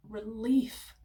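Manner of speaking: ordinary talk, nothing marked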